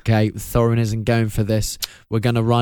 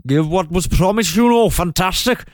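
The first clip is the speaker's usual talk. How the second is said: hammed-up Welsh accent, pitched way up; Welsh Bard; 8 semitones higher than usual. Bard pressing Thorin for promised gold, in a hammed-up Welsh accent. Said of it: "Bard turns up and says".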